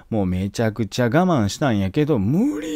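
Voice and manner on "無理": mock sob. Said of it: "ブワー言うて号泣してると".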